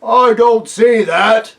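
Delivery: slow, loud doubtful bear. A narrator's reading. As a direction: drag it out like a bear. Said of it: "said one of the bears".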